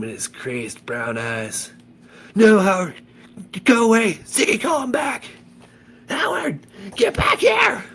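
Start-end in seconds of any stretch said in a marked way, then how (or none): 2.3-5.2 high, loud frantic yell; panicked shout. A narrator's reading. The narrator yelling at the charging dog, panicked high shout. 6.1-7.8 high, loud call; calling the dog. A narrator's reading. Ziggy calling his dog back across the stage, high loud call. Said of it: "called Ziggy".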